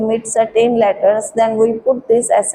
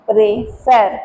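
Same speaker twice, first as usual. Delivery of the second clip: ordinary talk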